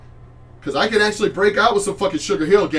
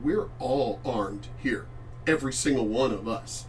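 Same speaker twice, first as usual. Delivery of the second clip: low quiet warning; 5 semitones lower, 8 dB quieter than usual. Real, not acted.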